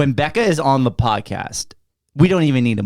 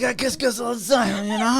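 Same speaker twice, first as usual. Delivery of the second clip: mock sleazy brag; 9 semitones higher, 5 dB quieter than usual. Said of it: "It's like".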